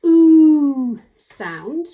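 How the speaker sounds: long oo as in moon; long ooh. Shown as a demonstration. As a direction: stretch a long bright ooh. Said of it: "how it can say the long".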